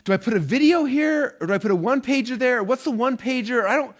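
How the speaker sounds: high, pleading confused asker; confused student. Retold or imitated. Student asking coach, confused and high-pitched. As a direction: play the lost student begging for answers. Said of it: "I've had a lot of people ask me".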